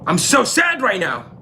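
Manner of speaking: high-pitched mock distress; hammed-up sadness; upset king. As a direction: complain like a suddenly miserable king. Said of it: "and he gets upset".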